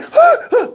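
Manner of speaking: high, frightened gasping cries; scared gasps. Retold or imitated. Cellmate scared of being unheard, high frightened gasps. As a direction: imitate a panicked man catching his breath. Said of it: "I think he was scared of that".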